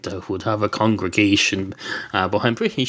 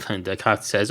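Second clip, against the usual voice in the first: usual speaking voice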